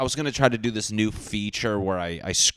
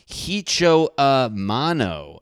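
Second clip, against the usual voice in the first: mocking drawn-out name; 6 semitones higher, 6 dB louder, much slower than usual.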